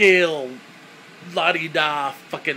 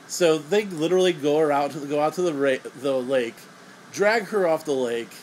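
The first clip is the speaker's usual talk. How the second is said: ordinary talk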